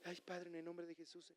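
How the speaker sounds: very soft mumbled prayer, barely voiced; preacher mocking the congregation's timid prayers, very soft; timid little prayer. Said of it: "no lo dijo en voz bajita, así como tú oras".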